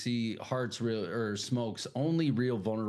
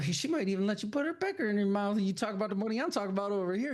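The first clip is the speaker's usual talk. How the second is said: mock voice; 10 semitones higher, much faster than usual. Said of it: "She's like".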